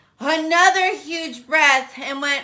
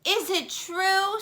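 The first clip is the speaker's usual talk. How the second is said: higher, softer coaxing voice; coaxing princess; 8 semitones higher, 4 dB quieter than usual. Acted. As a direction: lure him in gently. Elizabeth voiced again.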